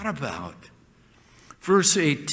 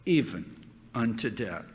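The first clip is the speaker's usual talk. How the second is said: quiet solemn echo; 8 semitones lower, 6 dB quieter than usual. Acted. Preacher echoing the verse to the congregation, hushed. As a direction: lower and softer, let it sink in.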